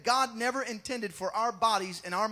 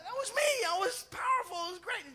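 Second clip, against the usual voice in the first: much higher, cocky protest; pitched-up mock indignation; boastful Peter; 11 semitones higher, faster than usual. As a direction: brag like a wounded fisherman. Peter bragging to Jesus.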